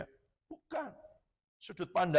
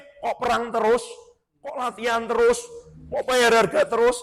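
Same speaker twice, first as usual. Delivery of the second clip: louder, higher mock grumbling; keluhan tiruan; 5 semitones higher, 8 dB louder than usual. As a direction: pile up complaints, each one louder. Menirukan rentetan keluhan. (rentetan keluhan yang ditirukan)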